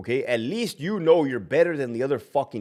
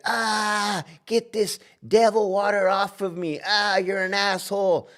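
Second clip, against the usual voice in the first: high mocking ex-wife voice, squealing; 8 semitones higher than usual. Ex-wife recoiling from holy water, high mock voice. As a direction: mimic the ex-wife, higher and shrill-sounding. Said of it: "she'll be like".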